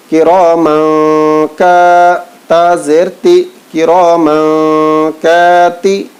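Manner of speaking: slow, chanted spelling recitation; Quran primer recitation. Shown as a demonstration. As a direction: chant each syllable slowly like a Quran class.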